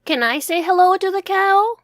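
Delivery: put-on a small boy bursting to ask; excited little boy. Max asking Mom to greet the cow, eager child voice.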